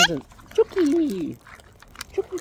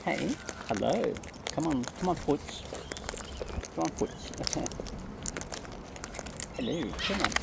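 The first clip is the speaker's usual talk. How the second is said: soft coaxing; 2 semitones higher, 9 dB quieter than usual. Real, not acted. Soft coaxing of bird Footsie.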